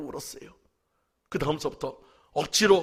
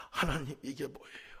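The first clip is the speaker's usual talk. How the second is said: hushed broken whisper to God; whispered lament; 7 dB quieter than usual. Retold or imitated. Pastor alone in church begging God, a broken whisper. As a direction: whisper the question, heartbroken.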